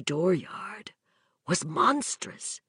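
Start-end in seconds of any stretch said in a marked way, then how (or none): none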